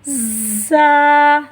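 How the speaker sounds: जा drawn out slow with a sharp buzzing z; sharp letter. Shown as a demonstration. Teacher shows students the sharp letter, slow and buzzing. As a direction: stretch जा with a sharp hissing z. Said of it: "तेज़ आवाज़ के साथ".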